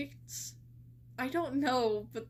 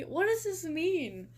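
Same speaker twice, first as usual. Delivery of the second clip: baffled; 7 semitones higher, 5 dB louder than usual. Real, not acted. Baffled again by profile text.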